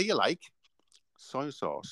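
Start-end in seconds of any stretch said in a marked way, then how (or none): 1.2-1.9 breathy stage whisper. Acted. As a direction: whisper it like a secret. Whispered curveball option.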